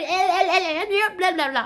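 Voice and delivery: high, loud mocking taunt; mocking insults. Mocking hateful tweets.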